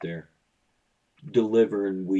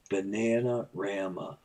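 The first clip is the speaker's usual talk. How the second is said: ordinary talk, nothing marked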